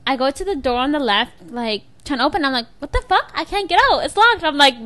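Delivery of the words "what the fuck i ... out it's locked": retold panic. Stuck in locked bathroom.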